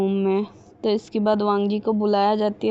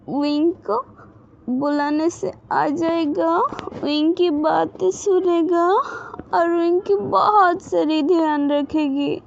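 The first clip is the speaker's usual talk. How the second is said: put-on lightly higher, slow little girl pleading; child voice; 6 semitones higher than usual. A narrator's reading. Little Wing pleading with mom about Gaga.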